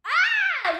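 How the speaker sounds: high, mic-spiking scream; piercing playful shriek; loud scream. Real, not acted. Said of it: "making the mic spike".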